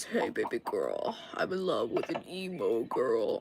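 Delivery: put-on e-boy voice. Shown as a demonstration. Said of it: "E-boy voice".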